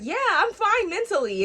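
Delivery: high, loud, overwrought insistence; mock distressed mother. Crying mother answers her best friend, high loud mock distress. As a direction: shoot the pitch way up, loudly protesting. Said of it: "you're fucking crying and pulling your fucking edges out".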